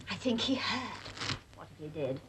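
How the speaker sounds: low, hushed and spiteful; muttered aside. Acted. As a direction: gossip under your breath. Spiteful aside about the guest.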